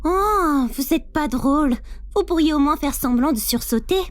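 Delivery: loud, teasing disappointment; playful complaint. Acted. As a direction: grumble that the prank fell flat. Prankster complains nobody was startled.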